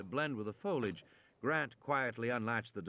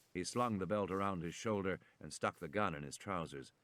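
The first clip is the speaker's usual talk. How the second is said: ordinary delivery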